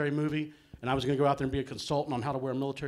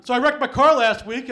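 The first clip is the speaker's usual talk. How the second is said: louder, higher retelling with car rising; re-launched louder with CAR rising; restart louder; 7 semitones higher, 12 dB louder than usual. Shown as a demonstration. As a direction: start the bit over, punchier. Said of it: "I'll back up".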